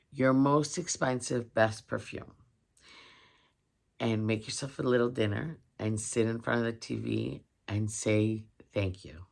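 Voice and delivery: normal talking voice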